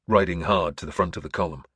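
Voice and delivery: ordinary delivery, nothing marked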